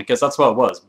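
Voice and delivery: normal talking voice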